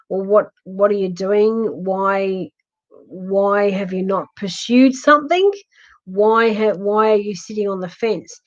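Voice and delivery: ordinary delivery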